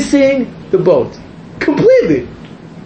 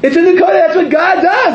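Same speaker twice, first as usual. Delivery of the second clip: amused outrage; 10 semitones higher than usual. Real, not acted.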